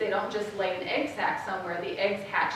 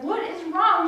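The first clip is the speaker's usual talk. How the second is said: lightly voiced exasperated mother, higher; exasperated mom; 8 semitones higher, 4 dB louder than usual. Mom to screaming daughter, mildly exasperated. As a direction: imitate a mom at wit's end.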